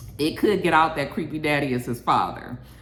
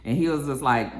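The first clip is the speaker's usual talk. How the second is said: usual speaking voice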